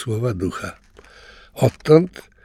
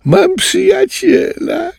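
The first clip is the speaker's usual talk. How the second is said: very high, slow happy shout; joyful cry; 4 dB louder, slower than usual. A narrator's reading. Little tapir Ciro cries out happily at new friend. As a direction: squeal it out with joy. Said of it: "krzyknął uradowany tapirek Ciro".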